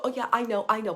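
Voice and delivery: mock brush-off. To moms, mocking their know-it-all brush-off. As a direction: imitate a mom waving it off.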